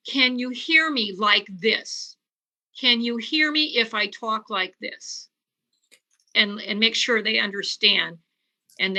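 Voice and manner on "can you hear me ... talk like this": slow, clearly spaced question; slow, direct speech. Shown as a demonstration. Doctor demonstrating talk to a hearing-impaired patient, slow and direct. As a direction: speak slowly and directly, without dragging. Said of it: "but slow. Like".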